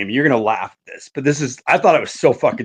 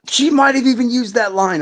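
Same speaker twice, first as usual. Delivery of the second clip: normal talking voice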